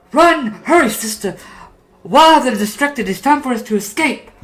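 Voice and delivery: put-on high, loud, urgent child puppet; kid puppet. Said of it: "You hear a voice go".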